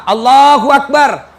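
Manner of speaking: slow, loud drawn-out takbir; takbir call. Shown as a demonstration. Preacher demonstrating the first takbir, slow and loud. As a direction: call Allahu Akbar slowly like an imam. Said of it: "Takbir pertama".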